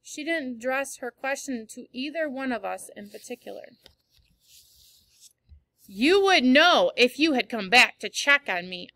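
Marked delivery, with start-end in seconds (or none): 5.9-8.9 sharp, louder spiteful jab; louder spiteful reproach to mom. A narrator's reading. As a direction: throw it back at her. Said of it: "I said spitefully".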